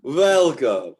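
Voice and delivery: loud, sweeping welcome; effusive tavern hostess. Acted. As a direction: play the grand hostess receiving guests. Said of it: "comes up to your table and says".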